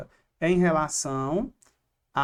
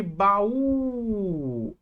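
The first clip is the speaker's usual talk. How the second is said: baú with a long held Ú; stress on last syllable; 2 semitones higher than usual. Shown as a demonstration. Teacher asking for the strongest syllable, baú with drawn-out Ú. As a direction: stretch the final Ú of baú. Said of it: "Qual é a sílaba mais forte".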